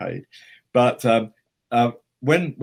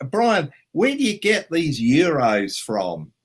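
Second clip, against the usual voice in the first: high, loud, drawn-out put-on voice; slow, high-pitched innocent query; Richard Taylor; 8 semitones higher, 6 dB louder, slower than usual. Asking his mate Brian about euros, high put-on voice. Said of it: "Richard suddenly said to me".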